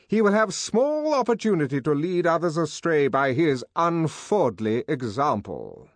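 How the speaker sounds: high, loud, formal denunciation; pompous director. Acted. Director publicly condemning Bernard, high and imperious. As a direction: condemn him before the whole room.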